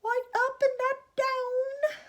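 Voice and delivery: high, slow, wailing protest; shouted. A narrator's reading. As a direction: wail it out loud in bafflement. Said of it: "they all shouted at once".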